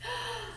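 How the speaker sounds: a quick, quiet shocked gasp; sharp gasp. Acted. Miss Robinson hearing news of Rosa Parks, with a sharp shocked gasp. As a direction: catch your breath in surprise.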